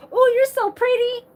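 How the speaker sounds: high, loud mock flirting; high-pitched parody of a drunken compliment; imitated drunk admirer.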